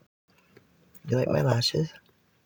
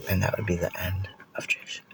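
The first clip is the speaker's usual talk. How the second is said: whispered dramatic ending; whispering; 3 semitones lower, 7 dB quieter than usual. Pretend. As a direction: hush it out like a finale.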